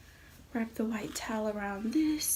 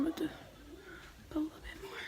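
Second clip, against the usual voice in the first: hushed whisper so he won't hear; 6 semitones higher, 12 dB quieter, much faster than usual. Real, not acted. Hiding, setting up the prank.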